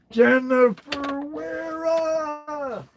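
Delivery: high, drawn-out call of the winner's name; stretched high announcer call; winner announcement. Real, not acted. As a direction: sing out the name like a game show host.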